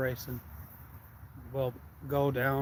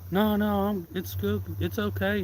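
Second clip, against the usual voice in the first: higher and louder, brushing off the warning; cocky dismissal; 7 semitones higher, 6 dB louder than usual. Retold or imitated. Kid brushing off the narrator's warning, mock cocky. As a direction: wave off the advice. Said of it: "I was like".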